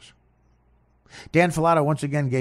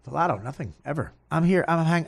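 normal talking voice

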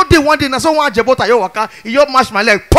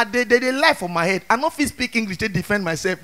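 usual speaking voice

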